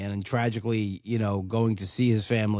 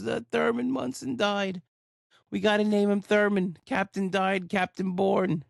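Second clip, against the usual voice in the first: much higher, mock tearful and slow; father crying; 8 semitones higher than usual. Father tells his pregnant wife Thurman died, mock crying high. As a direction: imitate a choked-up father breaking the news. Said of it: "And my father's crying".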